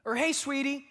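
loving wife. Retold or imitated. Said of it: "the first thing I hear is".

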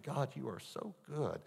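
quiet wonder at God's goodness; preacher recalling thanks to God, soft and slow; grateful. Retold or imitated.

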